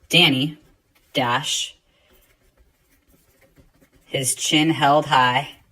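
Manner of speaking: slow read, long pauses at each dash; teacher dictating the Danny sentence, slow and spaced; slow dictation read. Shown as a demonstration. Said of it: "So looks something like this".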